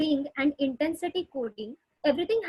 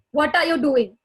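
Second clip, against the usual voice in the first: sharp, louder angry demand; 7 dB louder than usual. Shown as a demonstration. Teacher demonstrating an angry tone to a child. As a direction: snap the question like you're cross. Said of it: "So that my tone is angry".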